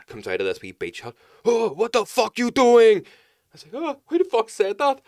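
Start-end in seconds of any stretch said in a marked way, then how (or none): 1.4-3.0 high, loud, slow shouted challenge; roaring at the drunk tourist on his trampoline; mock angry yell. Angry guard yelling from beach hut. 3.7-5.0 startled yelp. Retold or imitated. Startled reply to the guard.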